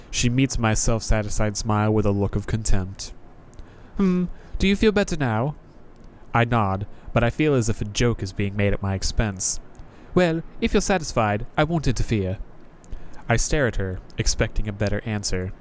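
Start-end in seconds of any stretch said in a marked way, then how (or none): 4.0-5.6 higher, world-weary knight; a jaded knight greeting a newcomer; higher sardonic knight voice; Crestfallen Knight voice. Acted. Knight sneers. 10.1-12.4 higher, world-weary knight; a jaded knight greeting a newcomer; higher sardonic knight voice; Crestfallen Knight voice. Acted. Knight dismissive.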